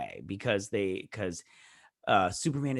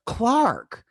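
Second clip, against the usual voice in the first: high, loud, falling cry of the name; swooping, emphatic one-word confession; dramatic name reveal; 11 semitones higher, 7 dB louder than usual. Retold or imitated. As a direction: blurt the name out, big and high. Names her secret love.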